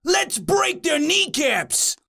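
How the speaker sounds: high, slow demon voice; a gleeful threat in a high, stretched demon voice; yelling demon. A narrator's reading. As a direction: draw it out high like an eager demon. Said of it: "yelled a demon from around the corner".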